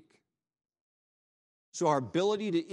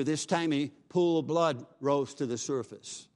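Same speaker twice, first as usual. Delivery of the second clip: ordinary talk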